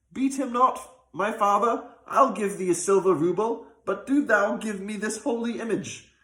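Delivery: put-on a youth begging the old man to stop; young man's voice. A narrator's reading. Young man urging the rich man to stop beating, earnest.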